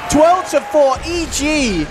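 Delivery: fast, shouted esports call; live play-by-play. Acted. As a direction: call the team fight at full hype. Live team fight commentary.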